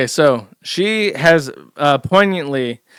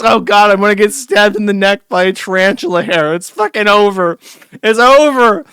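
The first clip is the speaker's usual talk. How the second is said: loud, higher, hammed-up dread; exaggerated panic, building to a louder peak; mock panic; 5 semitones higher, 6 dB louder than usual. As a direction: play a man comically sure he's doomed. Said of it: "He, like, thinks".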